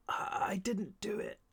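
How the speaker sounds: put-on boy on the edge of death whispering his innocence; dying boy whisper. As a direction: breathe the denial out with no voice. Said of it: "my terrible voice".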